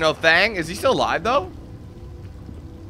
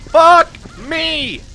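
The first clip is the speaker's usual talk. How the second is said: frantic yelling; 11 semitones higher, 6 dB louder, much slower than usual. Real, not acted. Frantic firefight against androids.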